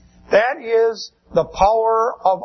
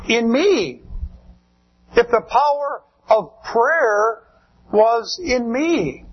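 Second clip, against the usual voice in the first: ordinary talk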